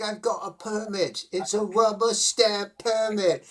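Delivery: high, slow, drawn-out movie-character voice; mock permit waver. Podcaster acting out the man waving papers at tribesmen, mock proclaiming.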